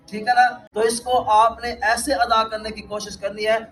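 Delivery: ordinary talk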